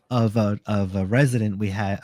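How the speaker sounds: ordinary talk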